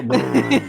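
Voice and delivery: ogre noise. Pretend. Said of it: "He speaks ogre".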